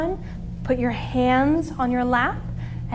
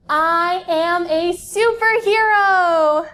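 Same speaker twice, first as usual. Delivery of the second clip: slow, higher proclamation; proud affirmation; 3 semitones higher, 3 dB louder, much slower than usual. Shown as a demonstration. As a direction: announce it slowly and proudly to the kids. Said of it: "yoga pose affirmation".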